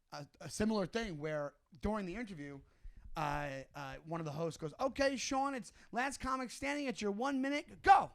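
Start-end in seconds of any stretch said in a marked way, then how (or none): 4.8-8.1 high-pitched host introducing a comic; show host's announcement. Retold or imitated. A host cueing a comic mid-interview, announcer-like. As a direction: announce it high, ending on a sudden loud cue.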